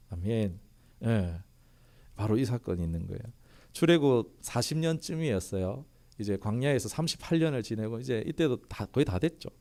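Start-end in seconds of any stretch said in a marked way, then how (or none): none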